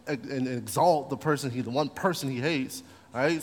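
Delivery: usual speaking voice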